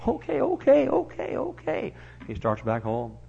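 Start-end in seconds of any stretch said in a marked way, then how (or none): 0.1-1.9 backing off meekly. Acted. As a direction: give in and retreat. Beggar backs off.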